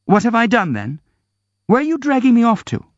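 high, fast indignant protest. Acted. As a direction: throw it back at him. Varya resists Ganya.

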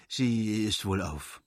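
slow, dark grumble; gloomy mutter. Acted. Karenin answering about his wife with a slow gloomy mutter. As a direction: mutter it grimly, slowly. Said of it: "murmelte Alexei Alexandrowitsch finster".

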